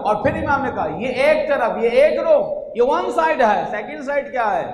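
a preacher calling out to the gathering. Real, not acted. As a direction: build up to full force from the pulpit. Preacher raising his voice.

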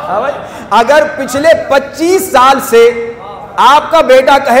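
usual speaking voice

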